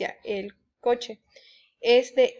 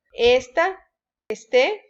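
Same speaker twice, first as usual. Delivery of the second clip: "Esta, este" said es-TÁ, es-TÉ; each word rising onto its final vowel, "Esta, este"; stress on the last syllable; 4 dB louder, much slower than usual. Shown as a demonstration. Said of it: "llevan acento porque son del verbo estar". ("Esta, este" con acento en la última sílaba)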